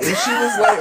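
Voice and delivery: high, breaking with laughter; can barely get the words out for laughing; laughing while telling. Real, not acted.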